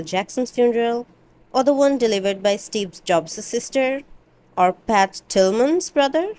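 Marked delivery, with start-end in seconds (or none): none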